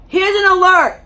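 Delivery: loud, high announcement; announcing alert. Real, not acted. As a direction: call it out loud.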